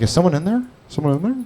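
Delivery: softly voiced query, rising at the end; muted outside voices. People outside the restroom asking, soft questioning.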